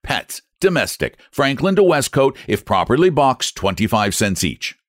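red-faced man losing his temper; furious customer. Acted. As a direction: jab every question at him. Furious customer argues rates.

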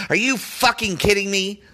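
ordinary delivery

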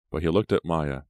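ordinary delivery

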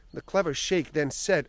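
ordinary talk, nothing marked

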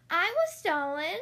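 high, slow little puppy voice; puppy calling up to the cat at the window; puppy cry. A narrator's reading. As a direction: cry it out like a small pup. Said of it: "cried Lucky".

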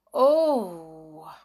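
breathy gasp then oh; gasp of wonder. A narrator's reading. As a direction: gasp in awe. Narrator gasps in wonder.

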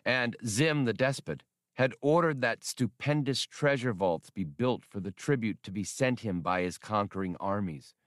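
usual speaking voice

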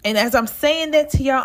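ordinary talk, nothing marked

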